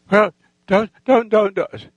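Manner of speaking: imitating a worried wife objecting; wife's urgent protest. Voicing wife's anxious objection.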